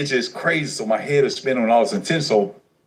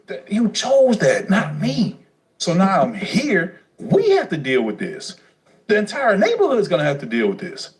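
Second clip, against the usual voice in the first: heated accusation; 9 semitones higher than usual. Retold or imitated. Son telling his mother she chose this, high and accusing.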